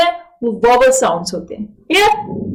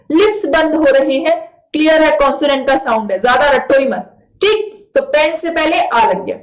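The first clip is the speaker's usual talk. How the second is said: ordinary talk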